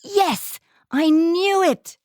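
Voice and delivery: high, slow triumphant yes; excited. Retold or imitated. Woman learning of African ancestry, high excited triumph. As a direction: savour proving it at last. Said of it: "I was so excited".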